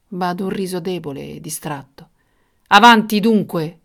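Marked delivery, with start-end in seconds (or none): 2.7-3.8 loud, higher impatient exclamation; impatient royal command. Acted. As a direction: urge them on, losing patience. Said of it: "esclamò il re impazientito".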